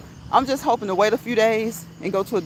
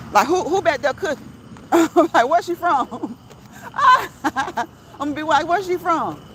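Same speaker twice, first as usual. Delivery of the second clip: high, loud question breaking into laughter; playful interrogation through laughs; amused mock questioning; 6 semitones higher, 4 dB louder than usual. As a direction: ask it laughing, pitched right up. Acting out nosy restaurant questioning.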